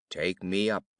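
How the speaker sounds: low frog voice. A narrator's reading. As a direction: demand it like the frog. Frog to the princess.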